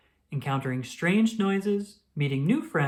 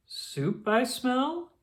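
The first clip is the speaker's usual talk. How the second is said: slow, higher, relishing the smell; savoring question; 8 semitones higher, much slower than usual. Acted. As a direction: drag it out like a hungry toad. Toad smells soup, slow.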